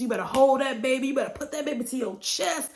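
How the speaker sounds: insistent, rising high and emphatic; urgent. Retold or imitated. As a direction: push her to hold the baby close. Urging her sister loudly.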